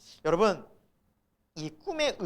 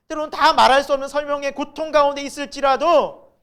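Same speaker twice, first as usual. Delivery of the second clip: loud, high preaching voice; impassioned preaching; 8 semitones higher, 12 dB louder than usual. Real, not acted. Telling the congregation all our lives are broken, impassioned preaching. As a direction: drive it home to the congregation.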